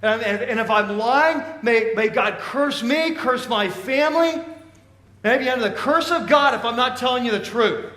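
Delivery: loud, heated oath; calling down curses. Acted. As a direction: swear forcefully with curses on yourself. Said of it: "begins to call curses down on himself".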